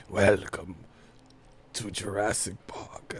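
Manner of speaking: low, soft, slow dramatic line; a hint of a movie trailer voice; movie announcer. Streamer quoting the movie after humming its theme, low and soft.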